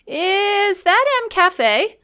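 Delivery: put-on high, drawn-out little kid voice; Finn imitation. Parent retelling the child spotting the big M, child voice. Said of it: "And Finn said".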